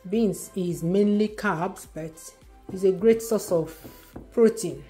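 normal talking voice

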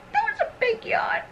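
very high, soft coo. Real, not acted. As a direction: owner cooing at her yawning puppy. Cooing at puppy's yawn.

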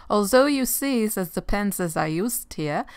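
playful mock German accent, pitch jumping up at the start; lilting pretend German accent. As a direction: slip into a put-on German accent. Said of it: "that was my German accent".

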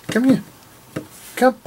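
high coaxing call to a dog. Real, not acted. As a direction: pitch it up high and call the little dog over. Calling the dog over.

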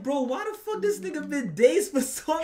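high, loud, disbelieving outburst; loud, high disbelief at a frozen character; incredulous yell. Retold or imitated. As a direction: yell it in mock outrage at the dazed guy. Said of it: "I'm like".

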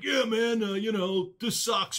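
a hint of an opinionated old rocker; opinionated metal dude. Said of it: "he may come off as a".

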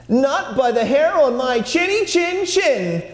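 high squeaky piglet voice; defiant and high; brave little pig. Acted. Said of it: "said the first little pig bravely".

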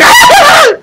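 high, loud hammed-up scary cackle; mock monster cackle. Scaring the game monsters back, hammed-up high loud cackle. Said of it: "Ahora yo espanto a los monstruos".

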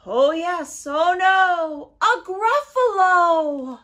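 loud, high storyteller's cry; dramatic reveal. A narrator's reading. Storyteller reveals the Gruffalo to children, loud and dramatic. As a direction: draw out the big reveal loudly.

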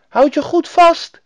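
put-on high, loud child's shout; little robber girl shouting high; calling out. A narrator's reading. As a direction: call after a departing rider. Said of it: "riep ze".

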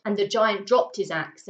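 ordinary delivery, nothing marked

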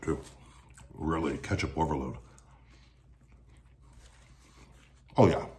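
chewing while talking; talking through a bite of food; mouth full. Real, not acted. Seen 1: just bit toast at -2; cheeks full, chewing while napkin wipes mouth.

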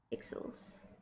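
breathy whispered word; a tiny unvoiced whisper to herself. Real, not acted. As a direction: breathe it out under your breath. Muttering to herself while drawing.

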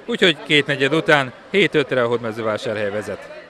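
normal talking voice

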